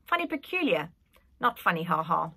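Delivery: ordinary delivery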